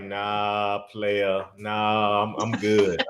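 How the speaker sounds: low, quiet, deadpan brush-off; cool refusal. Acted. Acted refusal of her advance.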